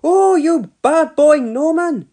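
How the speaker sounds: high, loud, slow scolding; embarrassed mum scolding her son; indignant mum. A narrator's reading. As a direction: scold him to cover your blushes. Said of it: "interrupted Dilys".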